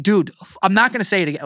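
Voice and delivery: last warning before losing it; retold angry yell. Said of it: "yelling at this dude".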